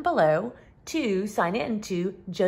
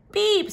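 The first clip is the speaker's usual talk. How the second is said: a bright high little horn beep; 11 semitones higher than usual. Acted. Little truck greeting the toad. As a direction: toot it high and quick. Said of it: "said Blue".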